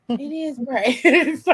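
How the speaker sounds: slow, laughing through the words. Real, not acted. Teen laughing at herself after her dad's answer. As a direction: crack up at your own question. Realizes it's just braids.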